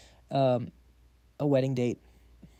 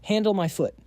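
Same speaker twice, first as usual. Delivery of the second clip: higher, dismissive scoff; scoffing huff; 5 semitones higher than usual. A narrator's reading. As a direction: brush off his excuse with a huff. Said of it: "sees right through him and huffs".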